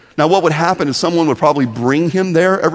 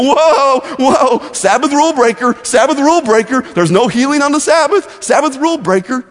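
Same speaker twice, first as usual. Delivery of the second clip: mocking religious leaders; 9 semitones higher than usual. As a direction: jeer it like a playground chant. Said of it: "They go".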